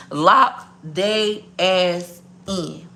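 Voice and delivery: LOCK THEY ASS IN, very slow with pauses; emphatic word-by-word. Real, not acted. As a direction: hammer home each word of "Lock they ass in" separately. Repeats advice word by word.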